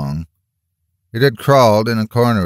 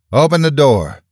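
high, loud command; loud sharp command to open up; 8 semitones higher, 6 dB louder than usual. A narrator's reading. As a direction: order it through the door, firmly. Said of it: "Missus Higgins commanded".

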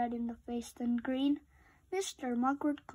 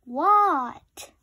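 loud, sudden reply; startled Froggy blurts what; 2 semitones higher, 10 dB louder than usual. Acted. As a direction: jolt awake and answer.

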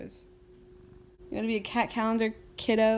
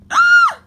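startled shriek; 18 dB louder than usual. Real, not acted. Sudden shriek at the cat.

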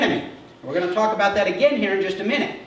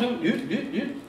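softly imitated "ooh, ooh" taunts; mock devil noises; 3 semitones higher, 7 dB quieter than usual. Preacher imitates the devil tempting Jesus, playful mock noises. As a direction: hoot it softly in a put-on voice.

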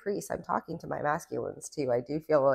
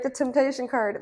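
excited; 9 semitones higher, 6 dB louder than usual. Real, not acted. Excited reveal of tarot card.

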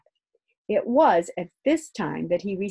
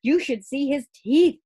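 put-on excited boy; 5 semitones higher, 4 dB louder than usual. Boy boasting excitedly to father.

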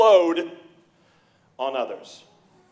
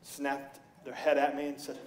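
ordinary talk

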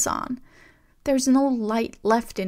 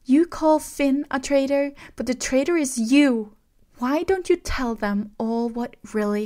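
trembling angry outburst; 3 semitones higher, 6 dB louder than usual. A narrator's reading. Rey accusing Kylo of betrayal, shouting with a trembling voice. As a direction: hurl every word at him, shaking. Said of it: "Her voice trembled".

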